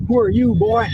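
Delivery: mock challenge rising at the end; suspicious local challenge. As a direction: imitate a local sizing up a stranger. Replay of acted locals' challenge.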